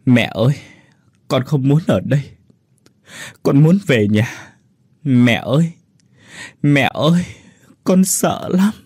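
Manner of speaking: put-on a frightened child crying out for mom; girl sobs alone, calling her mother; sobbing for mother. A narrator's reading. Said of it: "ôm mặt khóc nức nở".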